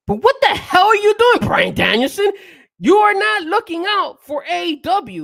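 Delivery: high, loud outburst at Brian Danielson; exasperated shout. Real, not acted. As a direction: yell it in disbelief. Heated wrestling argument, outburst.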